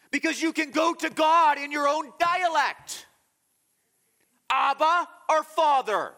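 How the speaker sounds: high, loud impassioned preaching; preaching at full force. Real, not acted. Preacher urges his flock toward God, impassioned and loud. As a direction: preach it with rising fervour.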